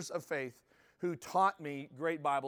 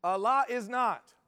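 louder, higher proclaiming Yahweh is God; proud declaration; 5 semitones higher, 5 dB louder, slower than usual. Retold or imitated. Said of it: "I love to remind him".

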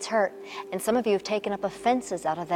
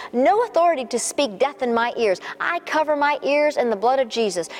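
rebuking prayer; 4 semitones higher than usual. Acted. Pastor rebuking anger for the viewers, commanding. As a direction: cast the anger out with authority.

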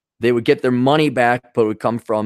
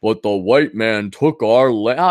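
mocking quote; 2 semitones lower, 3 dB louder, slower than usual. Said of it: "for whatever ungodly reason they threw in".

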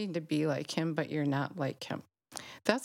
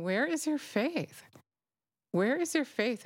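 Jesus rebuking; 7 semitones higher than usual. Retold or imitated. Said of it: "And Jesus is saying".

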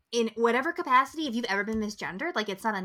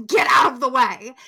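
mock impatient yell; 3 semitones higher, 9 dB louder than usual. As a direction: yell to clear the path, playfully exasperated. Mock yelling at slow walkers.

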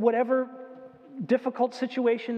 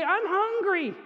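put-on child voice; 9 semitones higher, slower than usual. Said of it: "they say".

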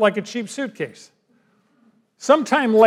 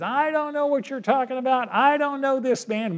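denying Peter; 5 semitones higher, 4 dB quieter than usual. Retold or imitated. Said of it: "Peter starts cursing and swearing".

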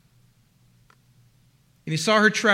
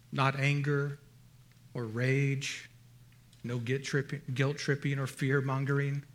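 soft, lower, lingering words of love; tender lover's voice; 5 semitones lower, 5 dB quieter than usual. Acted. Bridegroom Christ wooing his bride, tender and soft. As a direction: murmur it like a groom to his bride. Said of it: "he spoke tenderly to her".

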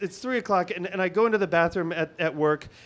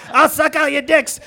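loud, high-pitched streetwalker holler; mock streetwalker yell at a car window; scabby streetwalker; 7 semitones higher, 7 dB louder than usual. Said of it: "this scabby Muppet head pops up".

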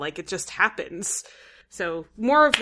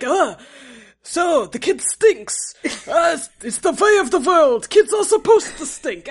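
put-on Yiddish grandparent accent; 7 semitones higher, 7 dB louder than usual. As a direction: shrug it off like a Brooklyn Yiddish grandpa.

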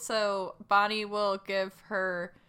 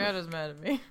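mutter; 5 semitones lower, 7 dB quieter than usual. Retold or imitated. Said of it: "Bonnie, like, mutters out".

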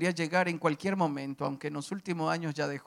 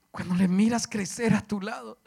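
usual speaking voice

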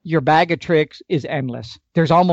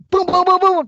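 excited booms; 12 semitones higher than usual. Retold or imitated. Describing bull charging heart.

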